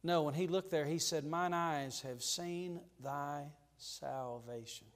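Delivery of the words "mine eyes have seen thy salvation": slow reverent quote. Acted. Quoting Simeon's words reverently.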